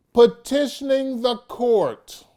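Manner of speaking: "petitioning the court" loud and high; booming emphasis; emphatic. Shown as a demonstration. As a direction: proclaim it. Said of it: "let me put some emphasis in it".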